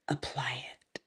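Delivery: softly whispered; a whispered nudge to the viewers. Acted. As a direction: breathe it like a secret. Whispered punchline.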